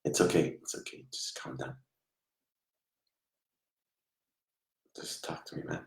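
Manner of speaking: hushed, gentle coaxing to a troubled spirit; fading into a whisper of comfort; soothing whisper. Real, not acted. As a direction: whisper softly to calm him. Medium calming the spirit.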